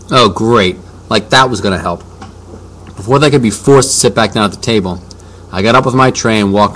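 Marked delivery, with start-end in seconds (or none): none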